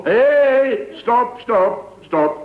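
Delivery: high shouted stop, stop; shouting stop. Acted. Man shouting at the woman to stop tugging the earring. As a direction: yell at her to stop pulling.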